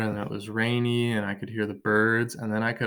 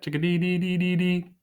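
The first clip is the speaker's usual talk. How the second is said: high, light birdcall imitation; chickadee call; 8 semitones higher than usual. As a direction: chirp it like a little bird. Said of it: "They make that ... sound".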